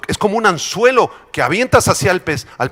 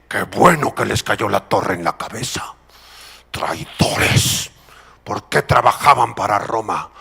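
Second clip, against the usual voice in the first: low, scornful muttered judgment; self-righteous onlookers, voice dropped low; gloating onlookers; 8 semitones lower than usual. Acted. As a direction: sneer at traitors under your breath. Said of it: "los otros judíos que decían".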